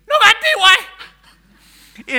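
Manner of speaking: very high, full-blast imitated scream. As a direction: scream it into the microphone in panic. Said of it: "He screamed".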